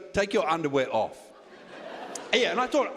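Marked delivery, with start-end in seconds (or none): 0.1-1.2 much lower, deadpan command; masseuse's blunt order. Retold or imitated. Comic imitating the masseuse ordering him to strip, low and deadpan. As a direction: drop the voice into a curt masseuse. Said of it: "she goes".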